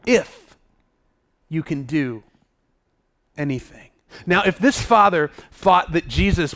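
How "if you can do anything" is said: hesitant, broken up by long pauses; doubtful. Shown as a demonstration. The father showing doubt to Jesus, hesitant and doubtful. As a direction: let doubt pull it down low and soft. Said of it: "Do you hear the doubt in his voice?".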